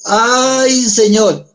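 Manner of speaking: high-pitched whiny ay Señor; lamenting Moses. Acted. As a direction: cry out like a reluctant Moses complaining. Moses complains to God.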